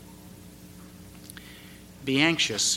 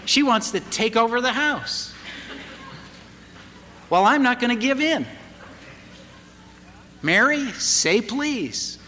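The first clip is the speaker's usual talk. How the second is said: rising insistence; 8 semitones higher than usual. Retold or imitated. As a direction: push harder each line until it's a demand. Father's growing power struggle.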